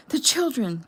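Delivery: loud, high panicked scream; a sudden shout of alarm. A narrator's reading. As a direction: cry out for the children in terror. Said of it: "Jeffy screamed".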